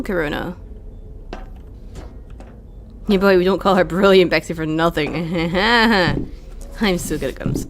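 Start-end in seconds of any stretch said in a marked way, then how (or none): 5.2-6.7 drawn-out chuckle; pleased, jokey laugh. Real, not acted. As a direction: laugh at your own boast. Boasting jokingly about own skill.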